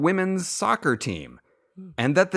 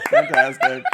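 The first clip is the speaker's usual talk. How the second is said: excited; 6 dB louder than usual. Real, not acted. Delighted reaction after the joke.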